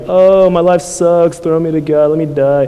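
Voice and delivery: hammed-up sulky moan about life; mock sulk of a fed-up prophet; pissy sulking. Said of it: "kind of being pissy here".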